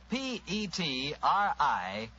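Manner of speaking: each letter slow and separate; P E T R I spelled letter by letter, slowly. Shown as a demonstration. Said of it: "because five letters".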